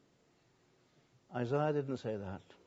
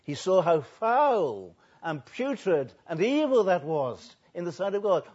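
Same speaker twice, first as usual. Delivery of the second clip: normal talking voice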